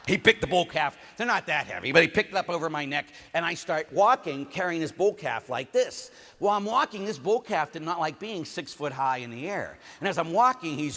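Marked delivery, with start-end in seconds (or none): none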